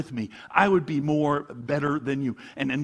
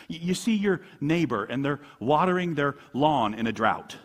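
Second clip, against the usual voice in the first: normal talking voice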